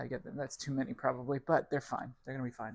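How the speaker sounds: normal talking voice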